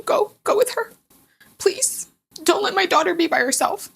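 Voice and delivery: high, choked-up plea; tearful pleading. Retold or imitated. Mother urging her husband to go with their daughter, tearful plea. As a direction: beg through held-back tears, pitched high.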